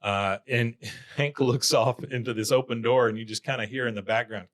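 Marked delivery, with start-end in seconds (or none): none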